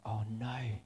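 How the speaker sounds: whispered "oh no"; hushed dismay. Retold or imitated. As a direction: let it out under the breath, dismayed. Dismayed reaction to sad report.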